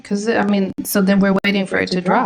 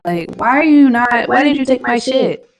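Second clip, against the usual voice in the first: higher, louder exasperated questioning; real angry rant at the trade; angry complaint; 6 semitones higher, 6 dB louder than usual. Real, not acted. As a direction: demand it of the platform, fed up. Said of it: "I'm getting so mad".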